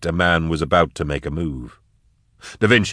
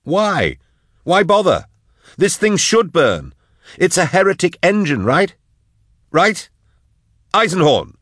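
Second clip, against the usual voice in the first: raised frantic voice; alarmed companion shouting, higher; 12 semitones higher than usual. Acted. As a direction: yell at him to stop. Drusha shouting at Eisenhorn.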